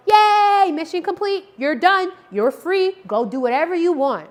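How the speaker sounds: high, loud, joyful yay; celebrating release. Shown as a demonstration. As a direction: throw a little party with your voice. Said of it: "let your dog know".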